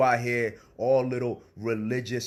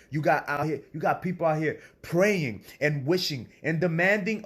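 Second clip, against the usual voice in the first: raised, fervent preacher voice; preaching; 6 semitones higher, 3 dB louder than usual. Real, not acted. To listeners who demand from God, fervent preaching. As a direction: preach it up high and strong.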